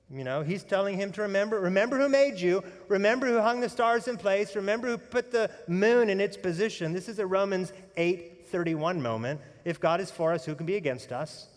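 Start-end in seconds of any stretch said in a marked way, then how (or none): none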